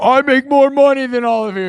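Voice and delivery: high, loud, pompous boast; hammed-up braggart crowing to a chat; mock bragging rich guy. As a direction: puff yourself up like a smug rich troll. Imagines a rich troll in chats.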